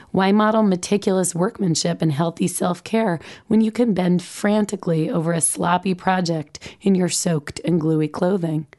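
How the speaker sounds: usual speaking voice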